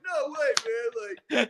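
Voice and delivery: high-pitched kid impression, laughing; Landon. Kid arguing in Minecraft, high laughing imitation. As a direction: imitate a protesting young gamer. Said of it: "but he's like".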